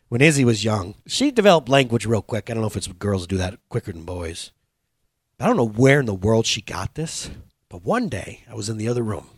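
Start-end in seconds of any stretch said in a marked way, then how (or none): none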